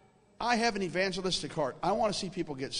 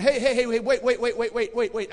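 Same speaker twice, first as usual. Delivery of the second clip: loud, higher burst of repeated pleas; urgent calling out; 4 semitones higher, 6 dB louder than usual. Retold or imitated. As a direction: hurriedly stop someone from closing. Calling to man closing the counter.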